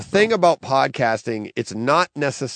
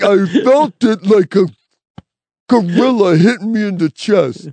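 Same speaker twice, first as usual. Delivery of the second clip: higher, louder, hammed-up review quote; mock gushing reviewer; 6 semitones higher, 8 dB louder than usual. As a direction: ham up the gushing critic. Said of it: "I'm like".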